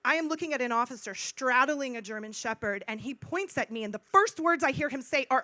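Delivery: ordinary talk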